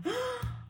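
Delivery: sharp, high-pitched gasp; startled intake of breath. Acted. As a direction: gasp in sudden surprise. Acted reaction to the confession.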